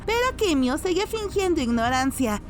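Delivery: ordinary delivery, nothing marked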